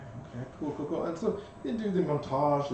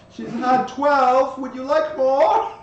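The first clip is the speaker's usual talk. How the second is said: louder, higher, slow and sly; teasing; 9 semitones higher, 8 dB louder, slower than usual. Acted. GM as waiter teasing a guest, slow sly offer. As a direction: needle her about the pastries.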